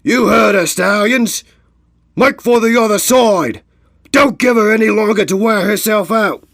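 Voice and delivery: loud, high-pitched rallying yell; rally the crew at full volume; shouted orders. Acted. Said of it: "spun and shouted at his cohorts".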